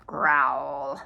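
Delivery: low growling dog sound; low menacing growl. Acted. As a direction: growl from deep down like a big dog. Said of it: "the answer from the other side was".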